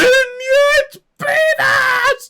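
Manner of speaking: very high, alarmed cries; panicked shouting. Real, not acted. Finds levees missing, calls beavers.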